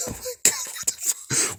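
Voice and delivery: breathless unvoiced whisper of disbelief; stunned whisper. Real, not acted. Reacting alone to a shocking clip, stunned whisper. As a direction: gasp it out under the breath, stunned.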